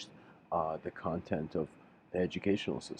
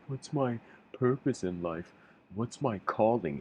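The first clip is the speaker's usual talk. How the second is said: higher, louder imitation of a questioning child; lightly voiced child; 5 semitones higher, 6 dB louder than usual. Voices a child asking about life.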